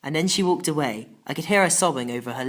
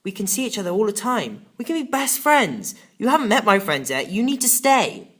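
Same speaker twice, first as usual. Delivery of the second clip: higher, louder pleading; pleading boy; 7 semitones higher, 6 dB louder than usual. Acted. Boy begging his best friend not to leave, pleading louder. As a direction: beg her to stay.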